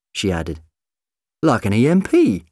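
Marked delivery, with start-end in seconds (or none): 1.4-2.5 high, loud, self-satisfied; chuffed, raised voice; pleased with himself. A narrator's reading. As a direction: offer it proudly like a clever answer. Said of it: "pleased with himself".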